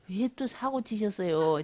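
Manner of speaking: 왜 또 사고 치셨어요 said slow and soft, mildly put out; a half-joking grumble at God, slowly; exasperated complaint. Retold or imitated. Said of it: "지금 이런 거야". (하나님께 살짝 투덜대는 말투)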